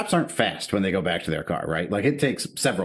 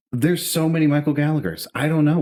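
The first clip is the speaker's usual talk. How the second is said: higher, emphatic retold protest; mock-helpless, raised pitch; exasperated; 7 semitones higher, 5 dB louder than usual. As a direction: throw up your hands at a silly question. Acted exasperated answer to the cop.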